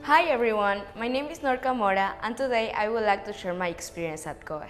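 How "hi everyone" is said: ordinary delivery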